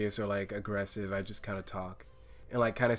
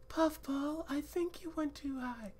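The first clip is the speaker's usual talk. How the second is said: very high and softer still; 8 dB quieter than usual. Acted. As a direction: float it up high and gentle. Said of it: "a soft, high-pitched voice".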